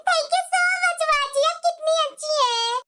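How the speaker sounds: slow, sweet, syrupy thanks; butter up the mother-in-law, drawn out; sugary fake thanks. (सास को मीठी-मीठी बनावटी शुक्रिया, धीमे)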